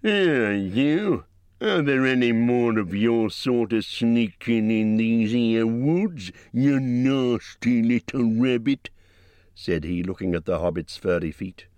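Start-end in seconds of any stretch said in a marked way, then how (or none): 0.0-8.9 menacing troll. A narrator's reading. Bert menaces Bilbo.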